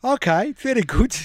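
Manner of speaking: bad South African accent; high, hammy South African accent. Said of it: "do my bad South African accent".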